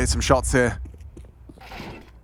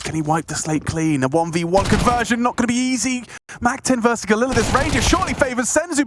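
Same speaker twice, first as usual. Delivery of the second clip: hyped caster; 9 semitones higher than usual. Pretend. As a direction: sell the play like a big moment. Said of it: "maybe if I say".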